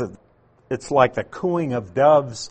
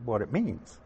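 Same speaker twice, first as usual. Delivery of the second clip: ordinary talk, nothing marked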